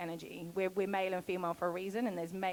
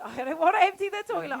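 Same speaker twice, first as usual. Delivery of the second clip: ordinary delivery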